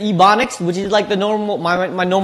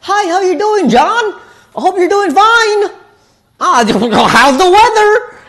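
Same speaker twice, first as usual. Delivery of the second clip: much higher, bright put-on small-talk voice with a laugh; 5 dB louder, slower than usual.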